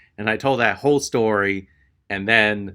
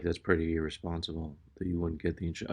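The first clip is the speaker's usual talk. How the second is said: low, fast, very soft mutter; muttering to herself; 6 semitones lower, 14 dB quieter, faster than usual. Retold or imitated. Clerk muttering to herself while typing, under her breath. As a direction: mumble it under your breath, barely audible. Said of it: "she's muttering to herself".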